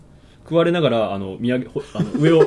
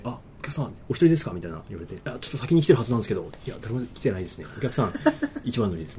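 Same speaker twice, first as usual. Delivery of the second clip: softly voiced staff small talk; retold staff exchange; 3 semitones lower, 9 dB quieter than usual. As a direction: act out a hushed, awkward front-desk exchange.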